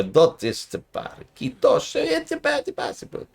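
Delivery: slow exaggerated Spanish accent, rising high then whispered; mock Spanish accent. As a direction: overdo a Spanish accent with big rising swoops. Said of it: "with a foreign accent".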